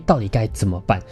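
ordinary delivery

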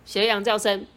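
ordinary delivery, nothing marked

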